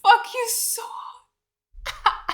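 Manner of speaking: a hushed, exasperated curse; whispered curse. Real, not acted. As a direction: whisper it through disbelief. Cursing the show.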